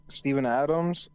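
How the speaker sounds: ordinary talk, nothing marked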